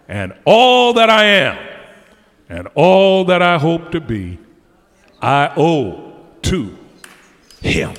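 loud, slow, ringing declaration; fervent closing preaching. Acted. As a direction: build to the final vow. Preaching climax.

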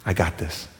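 low and soft, steady; God taking responsibility calmly; calm reassurance. Acted. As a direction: say it gently, in control. God's calm assurance.

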